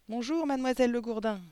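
high, loud chorus-like chirp; chirping children. A narrator's reading. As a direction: chirp a dutiful pupils' greeting up high. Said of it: "gazouillèrent-ils". (gazouillis d'écoliers, aigu et fort)

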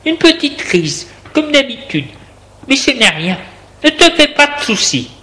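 high, lilting reassurance; reassuring partner. A narrator's reading. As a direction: play it down for the patient, pitched high. Woman reassures the sick man. (compagne rassurante, voix haute)

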